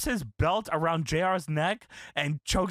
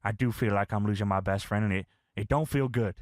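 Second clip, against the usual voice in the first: low, downcast confession; retold hurt friend, pitched well down; J R sad; 9 semitones lower than usual. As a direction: imitate a wounded friend, voice sunk low. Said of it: "J R's sad here".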